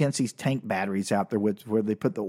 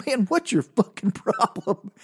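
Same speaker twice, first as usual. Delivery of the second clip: laughing impression; 9 semitones higher than usual. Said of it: "He goes".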